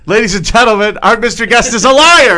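loud, high game show reveal; announcer shout. Acted. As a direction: boom it like an emcee. Announcing guest to audience.

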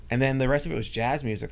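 usual speaking voice

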